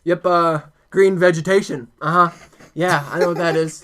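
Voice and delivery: mock-serious cop. Cop inspecting the tinfoil, put-on self-important seriousness. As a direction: play a cop trying hard to sound expert. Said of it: "he's trying to act all serious as a cop".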